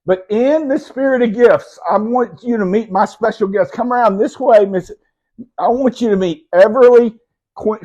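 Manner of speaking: loud, high showman intro; excited announcement. Real, not acted. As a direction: present the guest like a host. Presenting special guest to audience.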